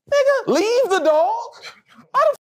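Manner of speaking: very high-pitched, disbelieving retort; incredulous outburst. Retold or imitated. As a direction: balk at an absurd order in a high, strained voice. Reacting to order to leave dog.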